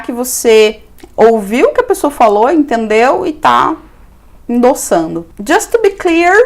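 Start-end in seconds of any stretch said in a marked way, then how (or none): 5.4-6.3 "Just to be clear" pitched high, lifting on "clear"; careful intonation. Shown as a demonstration. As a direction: demonstrate "Just to be clear" with a raised, lilting tune. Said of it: "com a entonação".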